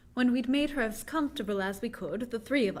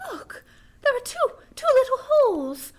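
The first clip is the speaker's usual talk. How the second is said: frightened cry; 12 semitones higher, 8 dB louder than usual. Acted. Mina discovers bite wounds.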